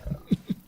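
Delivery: short soft laugh at himself; brief self-mocking chuckle; rueful laugh. Real, not acted. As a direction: let a quiet embarrassed laugh slip out. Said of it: "I can still laugh at this".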